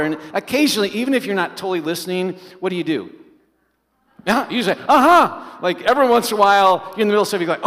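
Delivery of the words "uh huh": high, loud distracted uh-huh; mock called-out filler reply; absent-minded uh-huh. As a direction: toss off a loud uh-huh without listening.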